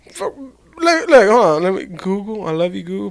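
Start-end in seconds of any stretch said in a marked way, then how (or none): none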